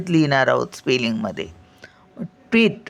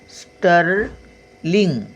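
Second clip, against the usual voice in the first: slow spelling-out; 3 semitones higher, much slower than usual. Shown as a demonstration. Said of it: "ङचा उच्चार".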